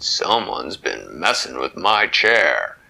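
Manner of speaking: a big father bear grumbling; deep slow annoyed papa. Acted. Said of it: "he said".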